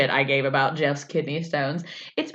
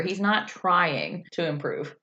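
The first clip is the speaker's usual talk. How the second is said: ordinary talk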